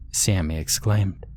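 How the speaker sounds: ordinary delivery, nothing marked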